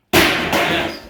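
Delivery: imitated bomb blast.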